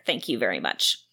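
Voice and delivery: ordinary talk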